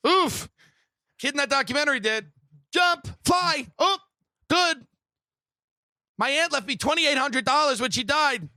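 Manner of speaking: high, loud, fast gamer urgency; mock frantic player; playing Mario. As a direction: narrate a video game level like you're mid-jump. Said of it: "they're like Mario".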